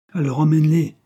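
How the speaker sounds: loud, high-pitched riposte; sharp retort. A narrator's reading. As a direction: fire back an order. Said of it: "riposta Stéphane".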